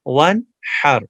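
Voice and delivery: ون ہر with the ہ breathed clearly from the throat; teacher models ون ہر, ہ from the throat. Shown as a demonstration. Teacher shows students clear ha from the throat. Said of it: "ہا کو clear نکالیں گے گلے سے".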